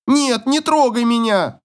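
put-on high, drawn-out girl's protest; hysterical cry. A narrator's reading. Daughter fends off father, high hysterical cry. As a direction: cry it high and slow in panic, not at full volume. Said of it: "кричит истерически".

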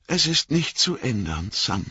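understated sorrowful resignation; sad. A narrator's reading. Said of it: "sagte Frodo traurig".

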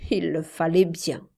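usual speaking voice

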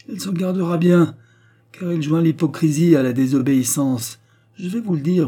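loud, high-pitched denunciation; angry accusation. A narrator's reading. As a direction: accuse with mounting indignation. Mother denounces daughter in quarrel.

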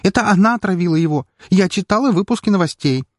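high, fast, overwrought outburst; agitated accusation. A narrator's reading. Maid blaming the baroness for the poisoning, agitated accusation. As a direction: insist on her guilt, near hysteria.

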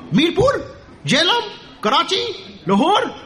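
high, teasing guesses, each a question; mock questioning. Preacher joking about where polytheists go, teasing high questions. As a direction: tease the crowd with silly answers.

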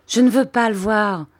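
high, loud protest; defiant refusal. A narrator's reading. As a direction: refuse outright as an upset child. Child refuses to meet stepfather.

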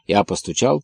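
ordinary delivery, nothing marked

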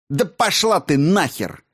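explosive dismissal; angry blurt. A narrator's reading. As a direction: blurt the insult out in fury. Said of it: "выпалил Соловей".

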